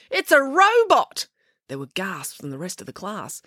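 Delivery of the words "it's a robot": high, slow excited cry. A narrator's reading. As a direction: announce it in shock, pitched high. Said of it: "he cried".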